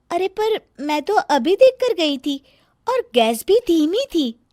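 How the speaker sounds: calm her bossy sister-in-law down; patient younger sister asking what's wrong. Acted. Sonam defends herself.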